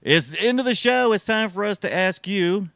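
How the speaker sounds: high, loud show-host fanfare; excited announcing. Pretend. Host tells the guest the show is ending, high excited announcing. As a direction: announce it with mock grandeur.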